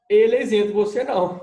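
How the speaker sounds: a hammed-up telling-off; mock scolding. Lecturer playfully scolding the imagined receiver, raising voice.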